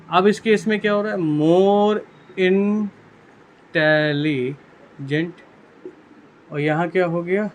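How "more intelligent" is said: very drawn out, word broken into syllables; stretched syllables with long gaps between them; slow dictation. Shown as a demonstration. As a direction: spell it out by ear as if writing along. Dictating slowly while writing it.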